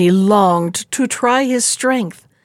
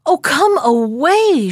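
higher, slower anxious cry; urgent plea; 5 semitones higher, slower than usual. Acted. Princess begs her husband to stay, anxious. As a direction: pull him back from the window. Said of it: "she cried".

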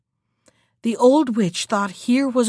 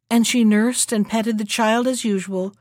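ordinary delivery

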